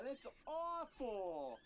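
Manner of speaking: loud, high and very slow; disgusted outcry. Real, not acted. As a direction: drag it out in horror at the noise. Reacting to the squeak.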